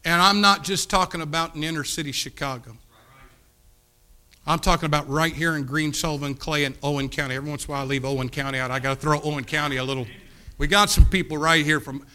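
loud, driving preacher's call; preaching. Real, not acted. Preacher rousing the congregation, loud and driving. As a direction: press it on the congregation at full force.

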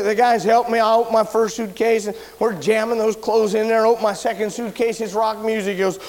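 ordinary delivery

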